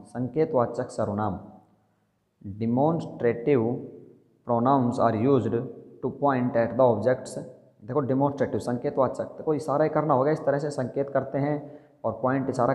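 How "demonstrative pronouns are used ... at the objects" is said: slow dictation. Shown as a demonstration. Dictating the definition slowly.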